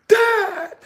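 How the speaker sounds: high, loud wailing plea; crying protest. Retold or imitated. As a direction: cry out in protest.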